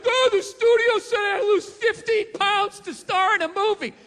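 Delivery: loud, high whiny complaint; mock whining. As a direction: whine like a spoiled star. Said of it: "it's like".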